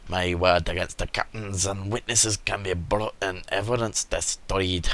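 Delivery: lightly voiced Scottish merchant; a hint of a Scottish storyteller; put-on Scottish accent. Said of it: "He's now Scottish".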